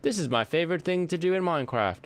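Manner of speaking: high-pitched mock YouTuber voice; Mumbo Jumbo. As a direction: imitate a YouTuber showing off a favorite build. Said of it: "Mumbo Jumbo, who's just like".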